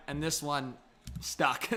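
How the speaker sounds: normal talking voice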